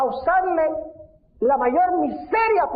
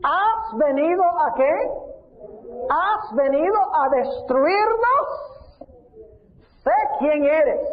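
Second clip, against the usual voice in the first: demon crying out; 6 semitones higher, much slower than usual. Retold or imitated. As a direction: shout it up at Jesus. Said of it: "Escuchen bien". (demonio gritando, agudo y lento)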